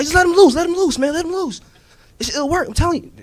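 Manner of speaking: eager rookie. Acted. Rookie cop begging partner to release the bull, high pitched. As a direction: beg like an overexcited rookie.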